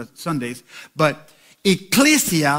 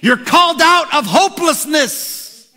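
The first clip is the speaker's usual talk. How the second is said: rising, shouting preacher's cadence; preaching; 10 semitones higher, 5 dB louder than usual. Acted. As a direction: build to a roar for the church. Preaching climax.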